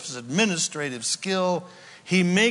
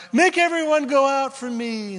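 high, loud command to clear the room; crying out; 10 semitones higher, 4 dB louder than usual. A narrator's reading. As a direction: order everyone out with full force. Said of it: "And he cried out".

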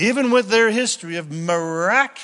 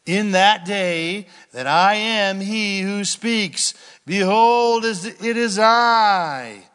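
scripture reading; 3 semitones higher, 4 dB louder than usual. Acted. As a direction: let God's words ring out. Reading Isaiah aloud.

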